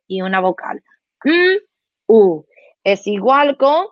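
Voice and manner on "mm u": drawn cow hum. Shown as a demonstration. Demonstrating mu sound.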